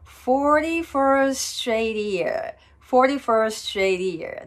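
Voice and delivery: forty-first straight ear drawn out as one linked phrase; smooth linking. Shown as a demonstration. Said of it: "っていうふうにつなげると、とてもスムーズ". (forty-first straight earを滑らかにつなげて)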